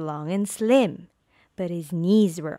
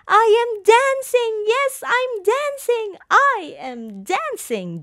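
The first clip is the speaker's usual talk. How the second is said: joyful cry; 13 semitones higher than usual. A narrator's reading. Gerald finally dancing under the moon, high joyful cry. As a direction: burst out with joy. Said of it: "Gerald cried".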